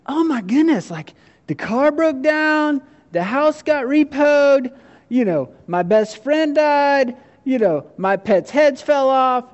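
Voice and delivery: high, slow, disbelieving list; stunned, tallying every disaster; looking back on a terrible year, high and amazed; incredulous recounting. Retold or imitated. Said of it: "you're like".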